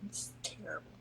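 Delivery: breathless hushed aside; whispered verdict. Retold or imitated. Host mocking herself as the audience thinks it, whispered. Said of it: "That's what they're thinking".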